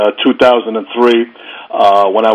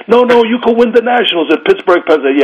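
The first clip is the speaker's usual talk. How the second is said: eager urging; 10 semitones higher than usual. Retold or imitated. Judge urging him to compete.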